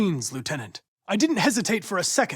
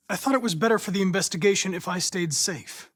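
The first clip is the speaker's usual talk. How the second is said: usual speaking voice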